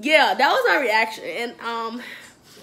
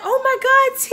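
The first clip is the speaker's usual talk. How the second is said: high, loud mock gasp; friend's gasp; 12 semitones higher, 7 dB louder than usual. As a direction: squeal it as the friend. Friend Lexus reacts.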